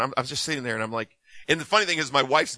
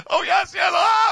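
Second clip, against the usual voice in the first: high, loud cry of yes; overwhelmed outburst, high and loud; emotional cry; 5 dB louder than usual. Retold or imitated. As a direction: let it burst out as you break. Retold breakdown into tears.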